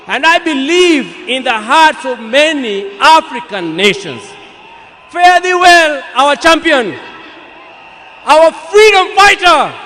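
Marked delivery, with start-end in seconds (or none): 5.1-9.8 raised, loud, slow ringing farewell; rousing farewell. Real, not acted. Eulogist bidding Baba farewell before the mourners, loud and soaring. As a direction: call out the farewell to the fallen leader with full force.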